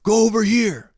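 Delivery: high, strained shouted order; mock yelling. Jumpsuit leaders directing freshmen, mock yelling. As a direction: imitate a bossy holler. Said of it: "they're, like, yelling at you".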